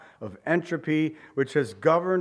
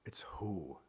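whisper; 8 semitones lower, 17 dB quieter than usual. Shown as a demonstration. Preacher whispers the answer.

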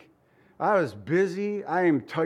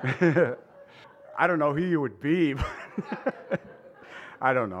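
slow words broken up by real laughter; amused, chuckling between phrases; laughing; slower than usual. Real, not acted. Amused at the comfortable-bubble thought.

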